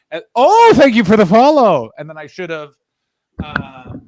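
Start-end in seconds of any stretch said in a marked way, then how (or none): 0.4-1.9 high, loud burst of gratitude; delighted high-pitched shout-out; excited thanks. Real, not acted. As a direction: break off to thank a new follower loudly. Thanking a new follower mid-story.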